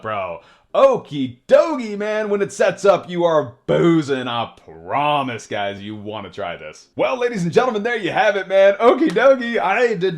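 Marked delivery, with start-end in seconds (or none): 0.7-4.3 high, loud, drawn-out excited cheer; hyped celebration. Real, not acted. Streamer celebrating a deck that just won, loudly hyped. 7.0-10.2 high, loud showman's victory call; triumphant announcing. Real, not acted. Streamer announcing the win to viewers, triumphant and hyped.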